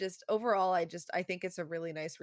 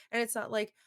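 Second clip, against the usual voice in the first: ordinary talk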